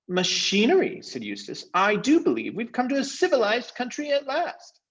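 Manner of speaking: very high, know-it-all voice; smug Eustace. Acted. Eustace dismissing Caspian's magic, very high and smug. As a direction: scoff at the idea of magic.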